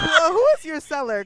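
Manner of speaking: high, loud disbelief; loud, incredulous mock outrage; incredulous yelling. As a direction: shout in mock outrage at a ridiculous tweet. Repeats mocking question.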